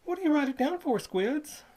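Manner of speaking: put-on high, eager young boy; a country lad answering, pitched high; boy's voice. Boy answering the toll keeper, high and eager.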